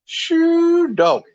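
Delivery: high-pitched "Shoo"; amazed whoop. Real, not acted. Describing gator eyes at night, awed high whoop. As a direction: whoop it out in awe.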